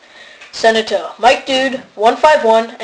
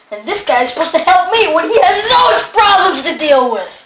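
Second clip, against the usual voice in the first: heated complaint; 7 semitones higher, 3 dB louder than usual. Acted. Heated mock quarrel.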